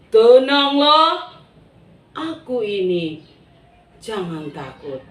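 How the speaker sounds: calm them gently; slow calming words. Acted. Jesus calming frightened disciples, slow and reassuring. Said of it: "Tuhan Yesus bilang".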